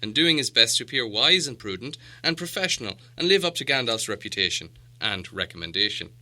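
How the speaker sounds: usual speaking voice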